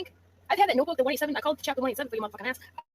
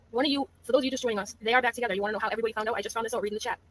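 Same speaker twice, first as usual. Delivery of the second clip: ordinary talk, nothing marked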